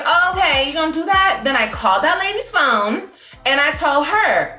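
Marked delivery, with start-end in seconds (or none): 0.0-1.4 high, mocking challenge; sassy retort. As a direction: throw it back at him with attitude. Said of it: "Then I was like".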